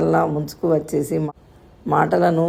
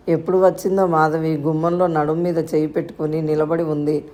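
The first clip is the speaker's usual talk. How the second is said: ordinary delivery, nothing marked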